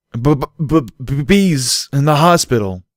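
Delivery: loud, high, slow stuttering panic; a foal panicking after a nightmare, loud stammer; terrified stammer. A narrator's reading. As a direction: stammer out the horror, shaking. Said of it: "Bucky stammered".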